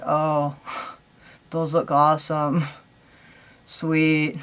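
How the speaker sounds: low, slow, sighing lack of enthusiasm; underwhelmed. Retold or imitated. Vlogger recalling launches that left her cold, underwhelmed. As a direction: say praise with no excitement behind it. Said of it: "I just don't feel excitement about 'em".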